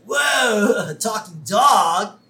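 loud, high amazed stoner. Retold or imitated. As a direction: gape at the talking dog. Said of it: "he's really like".

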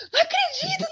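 high tearful shout of disbelief. Retold or imitated. On the phone hearing the news, shouting in disbelief. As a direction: cry out in joyful shock. Repeated disbelief, first take.

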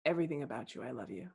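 hushed mock swoon. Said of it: "percepting even harder".

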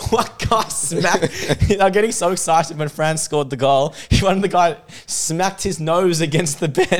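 high voice broken by laughter; real giggling retelling; laughing. Real, not acted. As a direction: try to tell it while cracking up. Cracking up at his own story.

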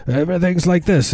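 put-on high old-film New England accent; twenties New England accent. Shown as a demonstration. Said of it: "the New England accent in the twenties".

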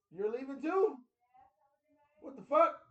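loud exasperated yell. Retold or imitated. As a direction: shout it out in disbelief. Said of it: "You can't be yelling".

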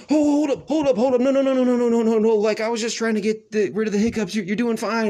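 high, loud, rushed no no no; frantically backpedal, high and loud; panicked teacher. Retold or imitated. Teacher panics at his crying.

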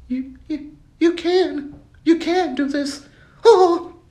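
squeaky high, timid cheerleader voice; a feeble little encourager squeaking up; mock good voice. The weak good voice cheering him on, squeaky high. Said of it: "Then you got another voice in your head".

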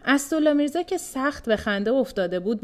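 plain reading voice

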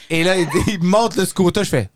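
usual speaking voice

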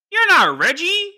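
high, loud, slow accusation; an old grandma pointing out an impostor; stern old lady. Retold or imitated. Grandmother confronts him.

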